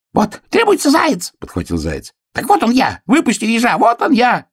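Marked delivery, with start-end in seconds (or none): 0.1-1.3 high, squeaky excited cry; excited hare. A narrator's reading. Hare excitedly seizes on the note. 2.3-4.5 high-pitched frantic hare; заячий крик. A narrator's reading. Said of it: "ещё громче крича".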